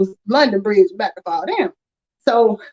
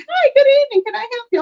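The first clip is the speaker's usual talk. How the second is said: chirpy clerk; 11 semitones higher, faster than usual. Retold or imitated. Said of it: "you have no supposed to be".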